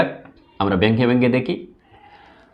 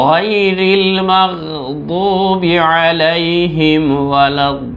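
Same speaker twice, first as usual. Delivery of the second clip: recitation; 3 semitones higher than usual. Shown as a demonstration. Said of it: "একসঙ্গে পড়ছি, শুনেন".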